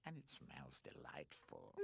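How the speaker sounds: slow, low, coaxing purr; tempting. A narrator's reading. Garbage can luring Pino closer, slow and coaxing. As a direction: lure him in, slowly and softly. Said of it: "tempted Mumph".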